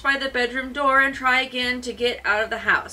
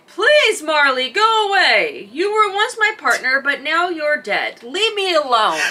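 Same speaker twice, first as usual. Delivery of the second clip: crying out; 3 semitones higher, 5 dB louder than usual. Acted. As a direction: cry out to the ghost. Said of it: "you hear Scrooge cry".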